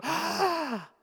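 high gasp of alarm, imitated; mock-startled intake of breath; audible gasp. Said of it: "You go".

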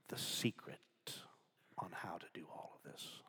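hushed whisper. Acted.

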